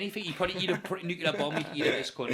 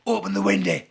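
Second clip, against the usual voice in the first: loud, raised menacing command; shouted order; 5 semitones higher, 10 dB louder than usual. Retold or imitated. Man at the car window ordering the driver, shouting. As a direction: imitate a scary man shouting through glass.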